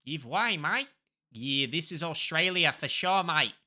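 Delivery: casual put-on Aussie; playful broad Australian vowels; Australian accent. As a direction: toss it off in mock Australian. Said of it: "I do an accent of where I think I am".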